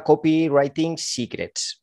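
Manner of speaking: English title with a Spanish accent, slowly. Real, not acted. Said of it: "mi pronunciación en inglés es una".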